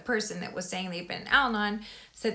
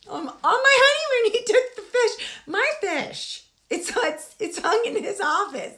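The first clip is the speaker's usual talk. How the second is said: much higher, louder, worked-up complaint; upset; 12 semitones higher, 6 dB louder than usual. Real, not acted. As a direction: rant about the stolen fish, heating up. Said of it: "now I'm getting upset".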